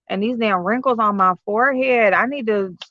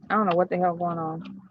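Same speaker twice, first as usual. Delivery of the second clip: normal talking voice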